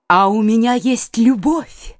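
usual speaking voice

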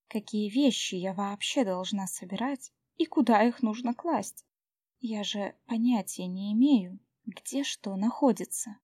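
plain reading voice

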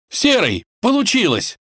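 high, thrilled prince's cry; exclaiming. A narrator's reading. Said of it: "успел воскликнуть царевич".

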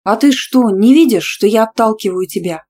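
loud, affronted challenge; indignant retort. A narrator's reading. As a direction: push back, outraged and forceful. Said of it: "округлила глаза от возмущения и процедила".

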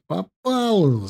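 high, drawn-out exclamation; delighted cry. A narrator's reading. Soldier cheering his comrade's shot, delighted cry. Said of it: "закричал в восторге Макар".

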